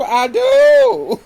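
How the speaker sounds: very high, loud laughing; laughing squeal. Real, not acted. Mocking Garcelle's fake line with a high laughing squeal.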